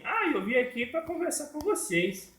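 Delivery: very high puppet grandmother voice; high-pitched little granny puppet. Acted. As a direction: answer as granny in a high falsetto. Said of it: "E ela vai falar assim".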